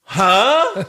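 ordinary talk, nothing marked

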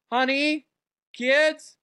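high, sing-out call to the household; acted casual shout to wife and kids. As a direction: holler through the house to the family. Calling out to family in skit.

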